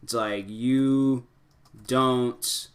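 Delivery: halting, half-speed dictation to oneself; composing aloud. Real, not acted. Advisor drafting a reply to a divorced dad, slow composing aloud. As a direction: stretch the words out as you type. Composing a comment while typing it.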